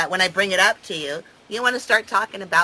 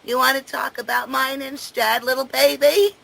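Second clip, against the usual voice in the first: higher, sing-song fake sympathy; 6 semitones higher than usual. Real, not acted. Taunting a partner who dodges their own problem, mocking baby talk. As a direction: coo at them to taunt.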